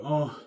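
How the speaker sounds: a mocking imitation of her moan; imitated moan. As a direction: ham up a short overheard moan. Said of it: "I heard her moaning".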